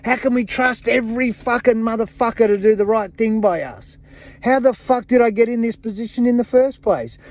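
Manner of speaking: imagined outraged people. Retold or imitated. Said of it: "people out there going".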